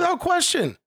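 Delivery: high-pitched exclamation; high, emphatic interjection; emphatic agreement. Real, not acted.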